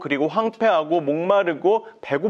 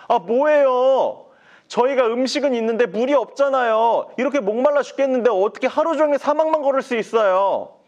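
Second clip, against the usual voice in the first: whining Israelites; 8 semitones higher, 4 dB louder than usual. Acted. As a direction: moan about dying of thirst. People complain about thirst. (높고 크게 불평하는 백성)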